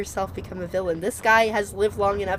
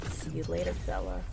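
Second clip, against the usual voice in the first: much lower, softer veiled threat; menacing villain; 7 dB quieter than usual. Pretend. Streamer repeats the game's farewell as a threat, low and menacing. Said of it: "That almost felt like a thinly veiled threat".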